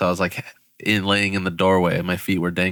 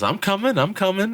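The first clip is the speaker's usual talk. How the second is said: high-pitched imitation of a rescuer calling out; Zach calling back; 11 semitones higher than usual. Sitter calls to panicking friend in tent, high mock reassurance. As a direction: lift the voice high, answering a cry for help. Sitter answers the cry for help.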